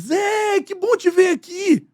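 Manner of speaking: very high, drawn-out delighted call; gushing, high-pitched hello; childhood-friend greeting. Shown as a demonstration. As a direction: greet a long-lost pal. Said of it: "aquela expressão de amigo de infância".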